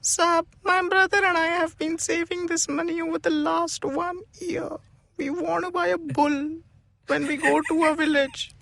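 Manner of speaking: high, soft pleading maid; weird maid voice. Acted. Maid pleading innocence to the boss, high and soft. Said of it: "in a very weird voice".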